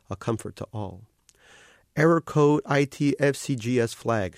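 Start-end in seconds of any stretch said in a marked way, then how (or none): 1.9-4.4 slow sighed readout; sighing. A narrator's reading. Older woman reads the error off his device, slow and sighing. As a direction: read the code with a weary sigh. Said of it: "she sighed".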